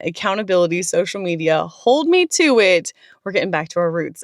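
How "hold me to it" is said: emphatic. Real, not acted. Dares viewers to hold her accountable.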